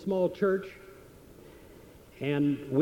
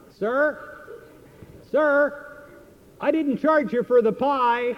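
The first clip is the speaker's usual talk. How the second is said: shouted; 8 semitones higher, 4 dB louder than usual. Retold or imitated. Waitress calling across the restaurant to him, shouting. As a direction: call out high and loud across the room. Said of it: "she shouted from the other side".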